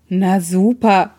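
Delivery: slow, sulky grumble. A narrator's reading. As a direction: pout the words out slowly. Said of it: "schmollte Hosenmann".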